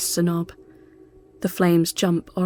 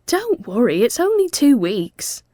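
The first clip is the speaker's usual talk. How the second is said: reassuring; 6 semitones higher, 6 dB louder than usual. A narrator's reading. Mel reassuring.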